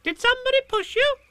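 very high, fast squeaky voice; excited Roo. A narrator's reading. Roo asking Eeyore who pushed him, excited squeak. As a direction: squeak it out loud and quick. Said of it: "said Roo excitedly".

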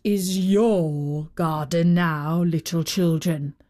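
slow, low giant voice. A narrator's reading. Giant to the little children, slow and low. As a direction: speak slowly and low as a storybook giant.

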